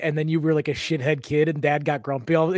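ordinary talk

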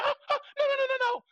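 high, loud flustered no-no-no; lady refusing. Retold or imitated. Retelling the cashier's refusal, in a high mock protest. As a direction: protest like a startled old lady.

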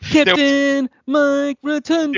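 drawn-out, high, loud ring announcer call. Pretend. Calling out the wrestler's name like an announcer.

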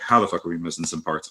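a mechanic asking how the parts went missing, low; low incredulous curse. Retold or imitated. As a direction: drop it low and quiet, disbelieving. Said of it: "You're like".

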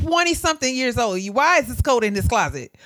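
retold exasperated daughter; exasperated scolding. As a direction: scold mom in disbelief. Retold scolding of mother over coat.

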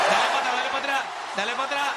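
loud, laughing plea to replay it; excited call to rewind. Real, not acted. Friend urging the others to replay the clip, loud and laughing. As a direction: shout it back to the top, grinning. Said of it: "quiero escucharlo otra vez".

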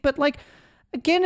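normal talking voice

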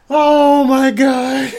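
high oh-my-God through laughter; laughing exclamation. Real, not acted. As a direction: gasp it out while still cracking up. Recovering from laughing fit.

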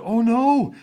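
sudden high, loud gasp of alarm; retold panic. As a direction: imitate a boy's startled dread. Child panics as teacher approaches.

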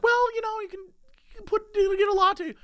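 very high, halting helpful voice; imitated barista. Barista steering customer to a latte, high imitated voice. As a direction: suggest it gingerly in a high mock woman's voice.

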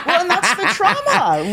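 high, laughing through the words; chuckling as she agrees. Real, not acted. Laughing at her friend's crying story.

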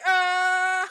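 loud, high comic wail; hammed-up groan of shock; mock dismayed cry. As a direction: wail it in feigned bewilderment. Mock dismay at endless building.

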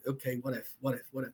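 quiet, hesitant inner doubt; worried muttering. Retold or imitated. Nervous second-time jumper to himself, quietly worried. Retells his fear before jumping.

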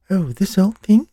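high, dainty English doll voice; a touch of English lilt, played high and prim. Little girl voicing a second doll, with an English lilt. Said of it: "with a bit of an English lilt".

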